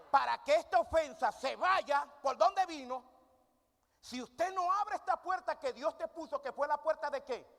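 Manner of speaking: high, loud preacher's cry; forceful preaching. Acted. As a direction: preach it out to the congregation. Preacher calls out to congregation. (predicación con fuerza, aguda y fuerte)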